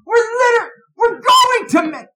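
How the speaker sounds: high, loud wailing yell; exasperated mom. Retold or imitated. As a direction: imitate a mother screaming at her husband from the doorway. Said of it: "My mom is going".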